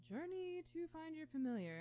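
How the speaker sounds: high, airy meditation-video voice; mock guided meditation. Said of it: "stupid guided meditations on YouTube".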